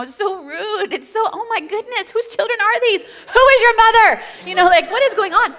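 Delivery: high-pitched shocked onlooker; judging stranger. Retold or imitated. Imagined onlookers judging her kids.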